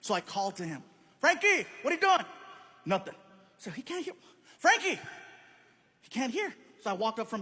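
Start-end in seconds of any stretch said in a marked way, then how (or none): 1.2-2.2 calling across the room, pitched up. Retold or imitated. Father calling son across room. 4.6-5.0 higher, sharper call; calling his name. Retold or imitated. Father calling son again.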